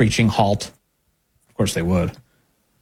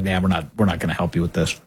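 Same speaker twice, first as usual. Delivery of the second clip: mock dismissive brush-off; 8 semitones lower, 4 dB quieter, faster than usual. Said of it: "Senate Republicans who are like".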